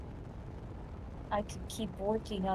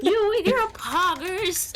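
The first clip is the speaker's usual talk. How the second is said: a touch of a scolding granny, higher and louder; old lady voice; 9 semitones higher, 6 dB louder, slower than usual. Retold or imitated. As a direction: lightly play a grumbling old woman. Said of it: "like, an old lady".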